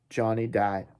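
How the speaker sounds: ordinary delivery, nothing marked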